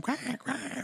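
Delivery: high-pitched duck imitation; duck quacking. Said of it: "quacking like a duck".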